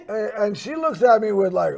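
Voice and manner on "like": plain reading voice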